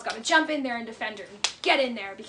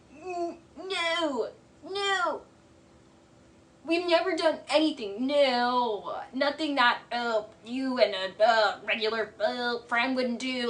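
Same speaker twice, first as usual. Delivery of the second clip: weird fake voice; slower than usual. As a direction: stammer it out like a bad liar. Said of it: "puts on the weirdest voice".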